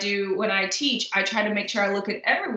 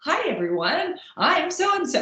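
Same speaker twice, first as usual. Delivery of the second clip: much higher, gushing hello with a pasted-on grin; overdone bubbly greeting; 8 semitones higher than usual. Shown as a demonstration. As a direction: greet the room like an overeager host, pitch shooting up on the name. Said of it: "Not that you have to be like".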